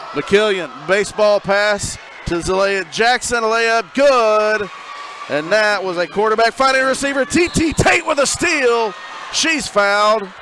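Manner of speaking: excited play-by-play. Real, not acted. Announcer calling a fast break and steal, loud and excited. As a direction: ride the rush of the play.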